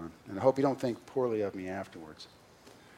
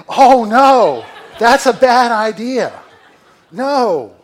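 much higher and louder, laughing, the middle slowed; mock panicked inner voice; 11 semitones higher, 18 dB louder, much slower than usual. Pastor voicing his own panicked inner voice, loud laughing wail. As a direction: cry it out as a comic panicked inner voice. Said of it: "a voice inside of me that gasped and said".

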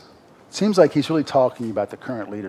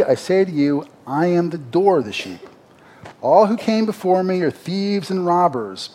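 raised, ringing preacher voice reading Jesus' words; scripture reading; 3 semitones higher, 4 dB louder than usual. Acted. Preacher to congregation, reading scripture with full force. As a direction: proclaim the verses like from a pulpit.

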